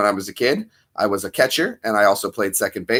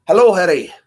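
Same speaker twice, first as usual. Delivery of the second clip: louder, higher British greeting; second try at the character, pitched up and punchy; Hagrid; 8 semitones higher, 6 dB louder than usual. Acted. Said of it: "Was that closer?".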